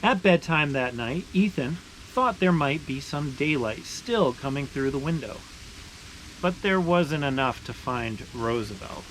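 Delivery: steady, expressive read-aloud voice; story narration. Acted. Reading a maple sugaring picture book aloud to viewers, storytelling voice. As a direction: tell it like a picture book for kids.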